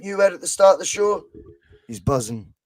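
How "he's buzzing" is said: buzzing said lower and softer, completely flat; flat low echo showing Ange's same tone; deadpan. Shown as a demonstration. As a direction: drop it low and deadpan like nothing happened. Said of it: "everything in the same tone".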